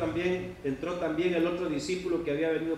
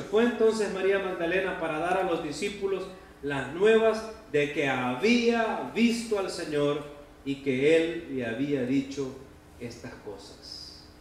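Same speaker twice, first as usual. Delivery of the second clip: ordinary delivery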